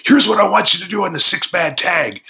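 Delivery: high, excited mock Paulie voice; a wired promoter pitching a plan; imitating a hyperactive promoter, pitched high. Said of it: "in his hyperactive manner".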